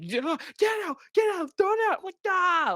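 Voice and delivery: high, frantic squealed orders; mock panicked shouting. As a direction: shriek as if a frog is loose. Acts own panic at a frog.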